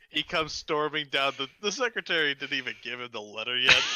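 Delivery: ordinary delivery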